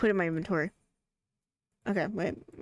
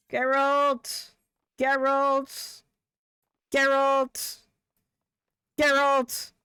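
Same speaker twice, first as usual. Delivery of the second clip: raised, drawn-out name call; 6 semitones higher, 4 dB louder, much slower than usual. Pretend. Streamer trying to get Geralt's attention, calling out. Repeatedly calling a game character.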